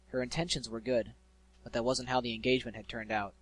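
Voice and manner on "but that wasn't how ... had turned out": usual speaking voice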